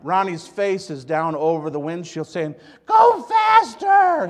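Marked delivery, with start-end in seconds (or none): 2.9-4.3 shrieking, very high-pitched yell. Retold or imitated. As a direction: scream it drawn out in a piercing high voice. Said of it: "He had a high voice".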